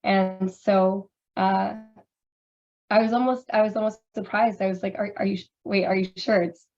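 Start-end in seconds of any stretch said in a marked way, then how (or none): none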